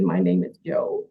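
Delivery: mock deep wrestler voice. Retelling how Abdullah first introduced himself, in a mock deep voice. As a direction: drop way down to play the wrestler.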